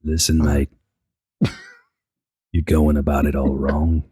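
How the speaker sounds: put-on low, measured British accent; deep-pitched, easygoing British reasoning; calm British mate. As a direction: talk him down as a patient British bloke. Voices calm British friend defending someone.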